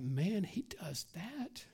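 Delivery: hushed, slow, breathless whisper; awed whisper. Retold or imitated. Preacher voicing a believer's amazement in a whisper. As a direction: breathe it out in awe. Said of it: "that we're like".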